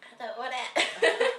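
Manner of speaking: laughing. Real, not acted. Laughing, refusing lemon.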